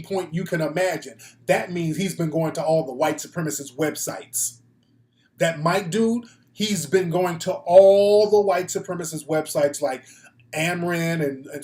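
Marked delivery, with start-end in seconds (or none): none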